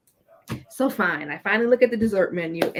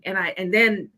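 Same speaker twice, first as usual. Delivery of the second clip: normal talking voice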